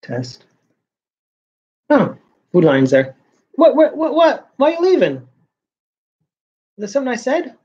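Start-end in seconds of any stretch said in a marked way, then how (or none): 3.5-7.6 high, plaintive what-what-what, surprised and hurt; baffled protest. Real, not acted. Calling after someone who walks off, high baffled protest. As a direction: call out in disbelief at someone leaving. Talking to someone walking away.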